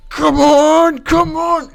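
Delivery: high, loud retold "come on" yell; exasperated shout. Retelling a friend failing the highway part, exasperated shout. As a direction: imitate a frustrated gamer's rising yell. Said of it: "I remember he went".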